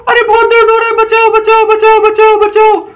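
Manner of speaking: very high witch crying for help; hammed-up cries of pain as a witch; mock pained witch. Witch in pain after injection.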